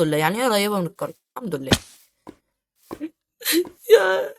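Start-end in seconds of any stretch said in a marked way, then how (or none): 3.9-4.3 mock sob. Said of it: "بطل عياط".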